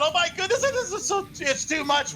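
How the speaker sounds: high, breathless-with-thrill outburst; overwhelmed excitement. Real, not acted. Caster overwhelmed by the close finish, excitedly exclaiming. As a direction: let the voice leap up, overcome.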